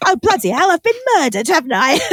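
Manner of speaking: exasperated Briton realising he's been murdered; mock exasperated Brit. Contestant complaining on finding herself murdered, mock exasperation.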